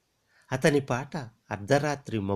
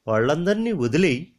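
louder, higher demand; raised urging; 6 semitones higher, 5 dB louder than usual. Acted. The man urging Latha to abandon her family, raised. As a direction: push her hard to let them go.

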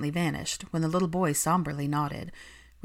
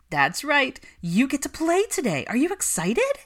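put-on higher, louder child-directed cheer; pep up a small child; animated; 9 semitones higher, 5 dB louder than usual. A narrator's reading. Nanny cheerfully coaxes the little boy, animated. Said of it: "sounding animated".

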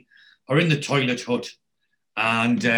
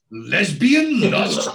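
slow, high, loud title reading; drawn-out reveal; 7 semitones higher, 5 dB louder, much slower than usual. Retold or imitated. Roommate reading out a hidden video's title, slow comic reveal. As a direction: stretch out both words for comic effect.

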